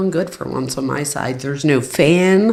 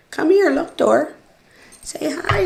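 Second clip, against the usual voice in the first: high sing-song coaxing of a pet; high cooing call to a shy dog; 11 semitones higher than usual. Real, not acted. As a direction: coax the dog sweetly, much higher pitch. Coaxing her dog to greet viewers.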